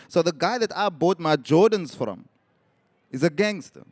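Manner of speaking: ordinary delivery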